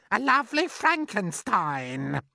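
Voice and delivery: high, mocking aunt's jab; spiteful aunt. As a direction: sneer the insult up high. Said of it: "Aunt Spiker said".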